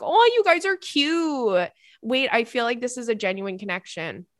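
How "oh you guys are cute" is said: high, drawn-out gush; louder, higher fond squeal of approval; cooing adoration. Real, not acted. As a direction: coo slowly over a cute photo. Gushing at the couple's picture.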